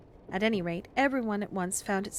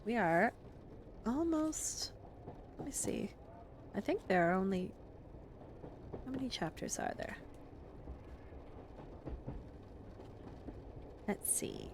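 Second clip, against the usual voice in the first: hushed aside; 11 dB quieter than usual. Real, not acted. Reader's aside counting chapters.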